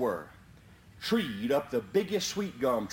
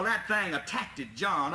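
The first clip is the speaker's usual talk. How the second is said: ordinary delivery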